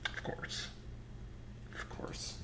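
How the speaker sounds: very soft, voiceless murmur; hushed. Real, not acted. Reacting to the Longhorn answer, hushed. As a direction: barely voice it, under the breath.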